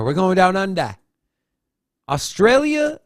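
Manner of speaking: put-on a playful Australian accent on the show intro; hamming up an Australian lilt, pitch jumping up; Aussie accent. Host introducing Australian news in a playful Australian accent. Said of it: "You leave the accents to me".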